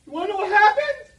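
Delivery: put-on very high, loud kid voice; squeaky little kid. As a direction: shout it like an excited first grader.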